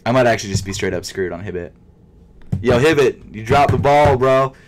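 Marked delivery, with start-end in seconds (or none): 2.6-4.5 louder scolding yo; calling out the store. Real, not acted. Vlogger scolds sneaker site Hibbit, loud call-out.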